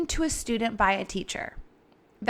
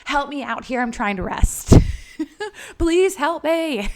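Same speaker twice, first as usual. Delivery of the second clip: louder, half-laughing plea to a higher power; mock plea; 4 semitones higher, 7 dB louder than usual. Calling to her higher power at night, mock pleading. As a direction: playfully beg for rest. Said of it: "and I'm like".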